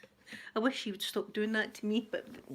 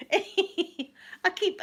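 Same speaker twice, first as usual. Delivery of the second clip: normal talking voice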